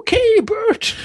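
high granny voice; hammed-up old dear; mock old lady. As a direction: answer Bert like an old wife.

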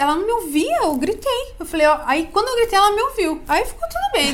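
hammed-up aunt boasting she screamed; dramatic aunt yelling. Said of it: "Ah, já sou bem dramática". (tia dramática, mais aguda e mais alta, contando que gritou)

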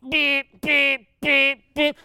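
high beep-beep-beep of an alarm clock; imitated alarm beeps. Preacher mimics his buddy's four a.m. alarm, beeping high.

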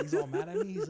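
high, loud, laughing through the words; can't hold back the laugh. Real, not acted. Laughing while retelling.

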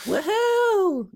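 high-pitched whoop from the stands; cheering whoop. Acted. Crew member cheers on the fighter, high whoop. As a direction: whoop it up like a supporter.

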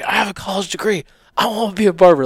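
high, loud retold objection; indignant protest. Husband protests to wife over going back to barbering, indignant. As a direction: push back hard, pitch jumping up.

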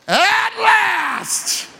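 high, loud, overjoyed cry; ecstatic shout. Shown as a demonstration. Preacher showing how Adam really said it, ecstatic shout. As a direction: shout it like a lonely man finally seeing Eve. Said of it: "I think he went like this".

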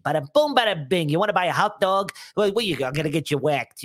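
New York wiseguy voice; Joe Pesci. Shown as a demonstration. Comic demonstrating the easiest New Yorker, brash New York impression. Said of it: "easiest New Yorker to impersonate is the Joe Pesci".

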